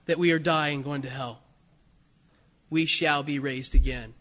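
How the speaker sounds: usual speaking voice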